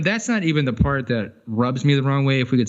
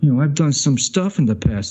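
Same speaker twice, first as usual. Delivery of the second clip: raised-pitch mock New York accent; bragging New Yorker; 4 semitones higher than usual. New Yorker bragging in small-town Texas, mock New York accent. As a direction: play a New York wannabe gangster. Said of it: "into a small town Texas going".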